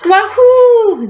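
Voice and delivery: high, loud whoop of joy; gleeful yell. Acted. Teacher voicing sledding snowmen for her class, joyful high yell. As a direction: whoop it like a kid flying downhill. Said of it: "they yell".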